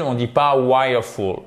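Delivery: ordinary delivery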